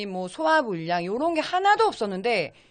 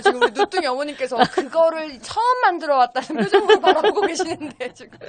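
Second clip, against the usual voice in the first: laughing; 6 semitones higher, 9 dB louder than usual. Real, not acted. Teasing, laughing at her face.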